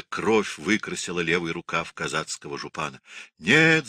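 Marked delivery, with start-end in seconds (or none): 3.4-3.9 a sudden, much higher, loud shout. A narrator's reading. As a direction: burst out with a defiant cry. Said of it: "закричал он". (раненый казак громко кричит «Нет!»)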